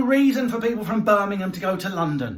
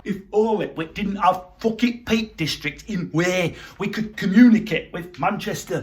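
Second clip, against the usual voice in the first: normal talking voice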